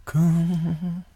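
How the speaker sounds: high, drawn-out stadium announcer call; ballpark announcer. Acted. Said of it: "あのトーンで言われる".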